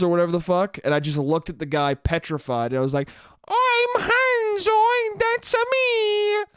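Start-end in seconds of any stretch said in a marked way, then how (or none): 3.5-6.4 very high, squealed fake Swedish accent; bad Swedish accent. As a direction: drag it out as an absurd shrieky Swede. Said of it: "somebody was being Swedish".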